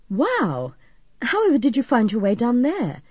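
impressed, astonished query; surprised. A narrator's reading. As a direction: react with wonder to the news. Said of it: "Chris sounded surprised".

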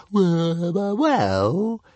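high, drawn-out dragon voice; a high dragon casually admitting; casual little dragon. A narrator's reading. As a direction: stall with a lazy sing-song. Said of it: "he admitted casually".

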